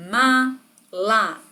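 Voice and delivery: MALA split into two slow beats, "Ma - la"; dividido em sílabas. Shown as a demonstration. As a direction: say Mala syllable by syllable, a pause between the two. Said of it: "Duas partes". (Mala separado em sílabas, ma... la)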